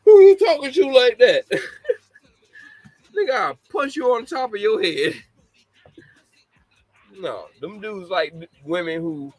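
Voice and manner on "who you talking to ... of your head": high, loud mock woman yelling through laughs; laughing mock confrontation, pitched way up; mock tough woman. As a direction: ham up a tall woman checking a man. Acted tall woman confronting man.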